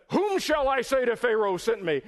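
high, loud acted question; calling out. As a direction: call it up to God as Moses. Voices Moses questioning God.

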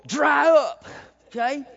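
loud, high parent's order; shouted order to kids. Retold or imitated. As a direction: shout it at little girls. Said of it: "I would tell my girls".

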